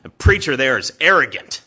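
loud, fast and blunt; loud brother. Retold or imitated. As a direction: announce it loudly to the whole table. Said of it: "he's like".

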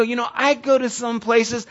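challenging retort. Retold or imitated.

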